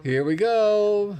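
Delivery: slow, high and loud; excited. Real, not acted. Host starting the baby goat video at last, high and slow. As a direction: drag it out with relief.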